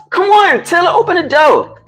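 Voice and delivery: high-pitched call through a door; impatient call. Real, not acted. Locked out, telling Taylor to open the door, impatient high call.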